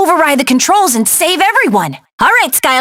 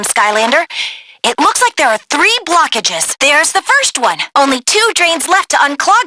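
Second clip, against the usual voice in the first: usual speaking voice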